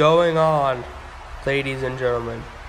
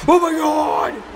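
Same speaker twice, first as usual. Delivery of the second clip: high, sudden cry of shock; alarmed high-pitched exclamation; startled shout; 11 semitones higher, slower than usual. Pretend. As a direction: yell out as something crashes beside you. Crash next to commentator.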